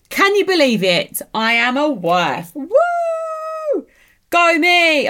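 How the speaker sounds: loud, high whooping celebration; excited cheering. Real, not acted. Newlywed to her viewers, whooping loudly with glee. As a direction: cheer for yourself.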